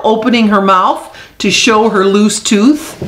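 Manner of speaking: plain reading voice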